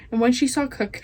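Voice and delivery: plain reading voice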